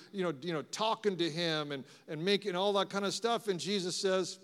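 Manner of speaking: ordinary talk, nothing marked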